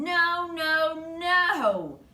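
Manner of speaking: high, loud cries of no; a hamster's loud, high-pitched no no no; dismayed no. A narrator's reading. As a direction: wail it in rising dismay. Hamster sees backward writing.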